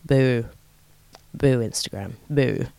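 low, soft, half-hearted boo; deep little mock boos. Mock booing Instagram, low and soft.

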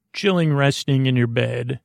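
normal talking voice

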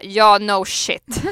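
loud, slow deadpan comeback; mock "tell me about it" reply; sarcastic retort. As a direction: drag it out as if it's painfully obvious. Sarcastic comeback to obvious advice.

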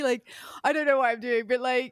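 normal talking voice

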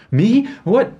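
put-on high, loud, incredulous young usher; startled young guy. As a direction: squeak out surprise as a young man put on the spot. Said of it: "It's, like, this young guy".

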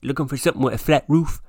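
fast, loud, laughing punchline; flat-roof joke. Pretend. Teasing co-host's flat bum, fast and laughing. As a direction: rush to the cheeky punchline. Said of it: "be like".

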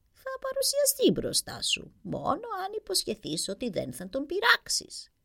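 put-on high, slow old hag voice; old witch voice. A narrator's reading. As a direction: croak it out like a fairy-tale witch. Witch bargains. (τσιριχτή φωνή γριάς μάγισσας)

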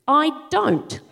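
ordinary delivery

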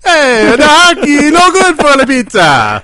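high, slow hammy Italian voice; terrible Italian accent. Pretend. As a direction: drag out a fake Italian accent. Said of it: "a terrible Italian accent".

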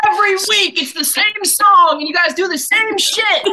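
high, loud exasperated rant; exasperated yelling. Real, not acted. Scolding friends over the same weekly song, exasperated yelling. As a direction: yell it at the top of your range, fed up with the running joke.

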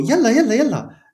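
high-pitched, urgent repeated "yalla"; hurrying someone up. Shown as a demonstration. Arabic teacher demonstrates how intonation changes meaning, hurrying. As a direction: chivvy them along, voice leaping up. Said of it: "esto quiere decir como, "Apúrate".

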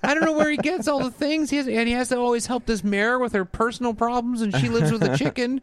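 high, exasperated disbelief; baffled parent ranting; incredulous rant. Retold or imitated. Bewildered rant about Paw Patrol.

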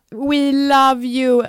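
very slow loud sung-out love; drawn-out declaration. Pretend. Calling out love to Emma, slow and loud. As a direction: stretch it out as a shout-out.

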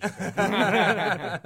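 loud, high, slow words through laughter; laughing. Real, not acted. Co-host joking about the actor's answer, laughing loudly. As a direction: finish the joke while cracking up.

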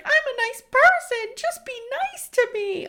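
hammed-up high weepy voice; mock crying plea. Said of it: "Then she's crying again".

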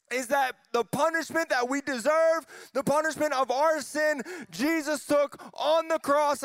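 high-pitched, fervent pulpit delivery; emphatic preacher, voice pitched well up; full-force preaching. Real, not acted. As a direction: preach it with rising passion to the whole room.

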